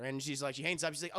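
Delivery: ordinary talk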